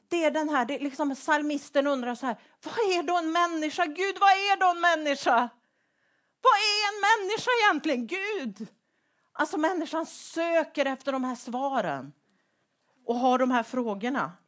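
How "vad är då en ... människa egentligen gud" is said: pleading psalmist. Acted. Psalmist asking God.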